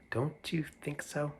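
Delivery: usual speaking voice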